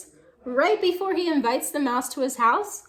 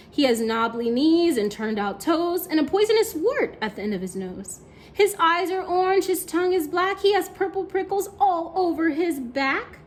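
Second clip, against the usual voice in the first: building, louder read-aloud; dramatic storytelling; 4 semitones higher, 5 dB louder than usual. A narrator's reading. Teacher reading the monster's description to kids, dramatic. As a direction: paint the monster big for children.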